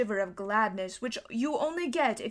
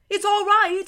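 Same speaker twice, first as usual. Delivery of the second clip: much higher and louder call; excited shout; 9 semitones higher, 8 dB louder than usual. A narrator's reading. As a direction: shout the good news from outside. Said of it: "he was shouting".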